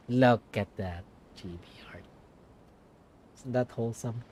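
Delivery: low, breathy hushed aside. Real, not acted. Streamer murmuring over a wholesome picture, hushed. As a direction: murmur it to yourself, barely voiced.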